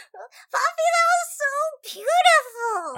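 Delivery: very high, loud and slow; awestruck child. Acted. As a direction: gasp it out in wonder. Ro amazed by the poem.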